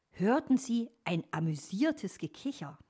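usual speaking voice

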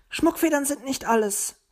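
high, disgruntled little bird; sulky. A narrator's reading. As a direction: sulk it out high-pitched. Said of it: "sagte Zwitschi missmutig". (hoch und missmutig)

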